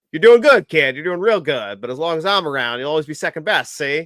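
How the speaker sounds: high, loud wise-guy impression; hammed-up old-gangster put-down; teasing movie quote. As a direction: play the cocky movie tough guy, loudly. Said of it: "and I'm like".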